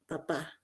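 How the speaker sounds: "papá" said slowly and softly, stress on final PÁ; acento en la última sílaba. Shown as a demonstration. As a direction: softly lean on the last syllable of "papá". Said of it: "el acento lo colocan en la sílaba". ("papá" suave, con la última sílaba acentuada)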